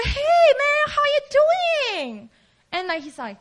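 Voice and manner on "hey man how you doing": very high, drawn-out chummy hello; fake-friendly greeting. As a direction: gush like a buddy hiding betrayal. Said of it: "it was Jesus' friend".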